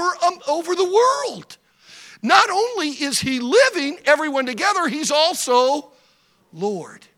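loud, high, slow preacher's cry; full-force preaching. Acted. Preacher proclaiming God's lordship loudly. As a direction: preach it out to the congregation.

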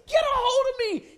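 high, loud frantic cry; desperate plea. Retold or imitated. Swimmer begging his friend to grab him, desperate plea. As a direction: cry out to be grabbed. Drowning man begs a grab.

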